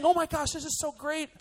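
high-pitched, marvelling enthusiasm; acted amazement. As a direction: gush in wonder at what you are seeing. Acting out the excited response wanted.

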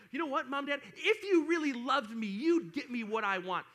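hammed-up bratty demand; guilt-tripping kid. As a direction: lay the guilt on your parents heavily. Acting upset child guilt-tripping parents.

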